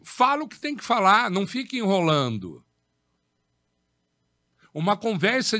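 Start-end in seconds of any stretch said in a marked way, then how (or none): 0.0-2.7 Fala o que tem que falar said higher and louder, fique punched; emphatic delivery. Shown as a demonstration. Teacher showing students what emphasis sounds like, higher and louder. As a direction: drive the point home with force. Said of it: "O que que é ênfase?".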